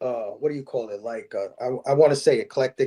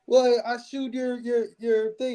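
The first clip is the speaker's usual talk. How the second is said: imitated quote; 9 semitones higher than usual. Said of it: "And he's like".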